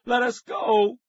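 high-pitched captive's yell; shout. A narrator's reading. Nicky held tight by the man, shouting. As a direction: yell it high, struggling to get free. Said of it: "Nicky shouted again".

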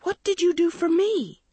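very high-pitched demand; frantic high question; crying out. A narrator's reading. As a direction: cry out, aghast. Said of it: "Andrea cried".

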